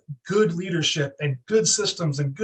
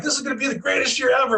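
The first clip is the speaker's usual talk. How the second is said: overexcited boast; 6 semitones higher, faster than usual. Retold or imitated. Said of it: "And I was like".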